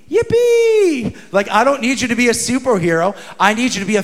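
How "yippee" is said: sudden squeaky-high cheer; God's giddy high-pitched yippee; gleeful squeal. Acted. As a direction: squeal it like a delighted child. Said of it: "God goes".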